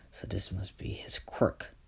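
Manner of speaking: unvoiced whisper to himself; whispered aside. Thug realises the darkness is Izuku's quirk, whispered. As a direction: breathe it out as a private thought.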